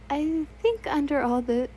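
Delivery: plain reading voice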